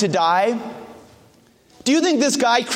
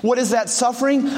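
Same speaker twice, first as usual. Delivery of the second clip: usual speaking voice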